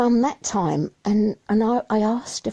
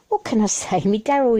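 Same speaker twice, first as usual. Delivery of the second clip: normal talking voice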